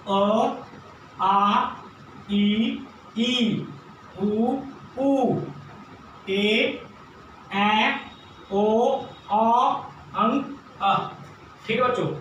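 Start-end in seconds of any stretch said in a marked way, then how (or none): 0.1-11.0 each vowel called out clearly and slowly; vowel drill. Shown as a demonstration. Teacher leading children through the vowel sounds. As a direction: chant the vowels one by one for the class. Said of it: "दोबारा देखिए".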